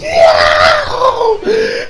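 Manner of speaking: very high, drawn-out yell; screamed cheer. Retold or imitated. Fan reliving the episode, high screamed cheer. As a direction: let out a long hammed-up cheer.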